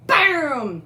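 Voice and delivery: loud, high burst falling away; explosive imitated blast; cannon boom. A narrator's reading.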